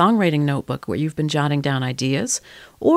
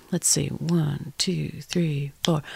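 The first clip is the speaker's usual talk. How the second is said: soft whispered count of four; hushed one two three four before the rhythm; 2 semitones lower, 6 dB quieter, slower than usual. Shown as a demonstration. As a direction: count yourself in under your breath. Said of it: "in some kind of a rhythm".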